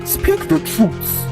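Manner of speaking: slow, high-pitched command; priest's measured, insistent order; stern demand. A narrator's reading. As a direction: demand it slowly, word by word.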